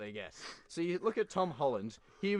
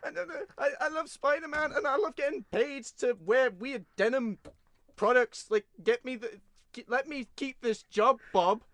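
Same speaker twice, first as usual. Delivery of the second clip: high, loud whiny plea, the American accent mocked throughout; mocking Tom Holland; 11 semitones higher, 7 dB louder than usual. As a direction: beg the boss like a needy kid, hammed up. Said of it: "He's like".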